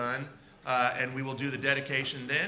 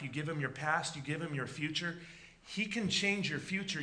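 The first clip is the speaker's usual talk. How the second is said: ordinary delivery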